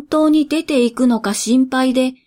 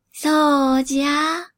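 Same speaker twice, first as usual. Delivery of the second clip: put-on slow, higher croaky old spirit; creaky wise old man; 4 semitones higher, slower than usual. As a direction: creak it out like an ancient god of poverty. Poverty god confirms.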